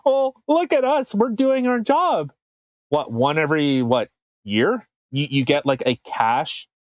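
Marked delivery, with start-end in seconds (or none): 0.1-2.3 high, smug mock bragging; a lightly voiced bragging cop; mocking boastful police. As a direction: show off like a proud cop, mockingly. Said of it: "the R C M P flaunts like".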